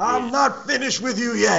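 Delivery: high, slow put-on voice; mock wrestler catchphrase. Co-host echoing the wrestler's catchphrase, high hammed-up threat. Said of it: "That's exactly what he told me".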